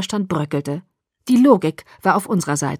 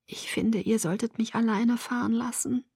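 much softer, near a murmur; very quietly; 4 semitones higher, 7 dB quieter than usual. A narrator's reading. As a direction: say it under your breath. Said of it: "sagte ich noch leiser".

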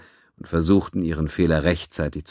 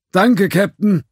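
higher, louder forced cheerfulness; forced cheer; 7 dB louder, slower than usual. A narrator's reading. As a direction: thank her with a fake smile. Judge forcing cheer.

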